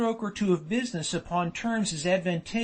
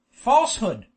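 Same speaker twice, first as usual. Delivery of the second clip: roared accusation, louder but contained; 3 semitones higher, 6 dB louder than usual. A narrator's reading. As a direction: burst out loudly in indignation, narrator-style. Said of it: "roared Rosette".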